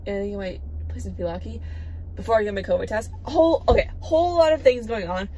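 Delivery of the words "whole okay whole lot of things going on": flustered. Real, not acted. Flustered after car news.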